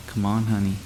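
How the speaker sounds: soft, far-off mimic calling in the night; hushed, haunting coaxing call; eerie distant call. A narrator's reading. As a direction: call faintly from outside the window. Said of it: "my voice called out".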